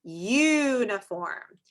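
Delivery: "Uniform" very slow, first U held; long U sound. Shown as a demonstration. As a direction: stretch out the "you" in "Uniform". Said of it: "Listen again".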